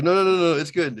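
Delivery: high, loud quick no no no; hurried reassurance. Retold or imitated. Reassuring girlfriend at the table, high and quick. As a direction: rush to stop her apologizing. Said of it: "I was like".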